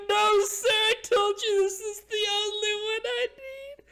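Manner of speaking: very high, loud drawn-out wail; panicked wailing. Acted. Prisoner told he loses his right hand, loud high panicked protest. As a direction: protest in a desperate falsetto cry.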